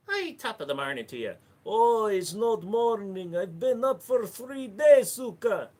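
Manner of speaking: high, loud mock Irish brogue; drunk Irish accent. Streamer greeting Snuka in a drunk Irish brogue. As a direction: play a tipsy Irishman, hammed up. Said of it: "Hey, Snuka".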